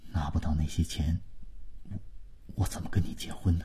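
low, hushed and halting; very small voice. A narrator's reading. Boyfriend confessing money trouble after long silence, in a very small voice. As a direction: admit it quietly, pitch dropped. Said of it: "才用很小的声音说".

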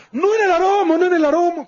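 high mock yell imitating the assemblywoman; mock shouting woman. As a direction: shout it like she stormed in screaming. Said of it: "porque entra gritando". (imitando a la asambleísta que entra gritando, aguda)

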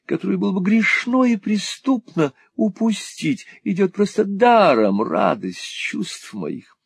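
high, slow rapturous drawl; rhapsodize, stretching the temptation; foppish wheedling husband. A narrator's reading. Husband coveting a horse, high rapturous drawl.

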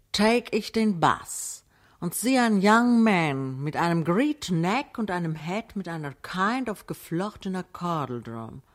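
usual speaking voice

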